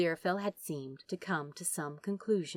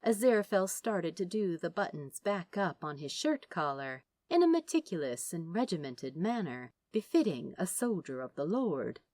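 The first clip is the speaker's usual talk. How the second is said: plain reading voice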